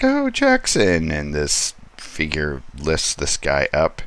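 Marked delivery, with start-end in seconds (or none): 0.0-1.1 put-on much higher, louder girlfriend cry. Girlfriend calling to her boyfriend in alarm, high cry. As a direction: gasp out the name in alarm. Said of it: "she's like".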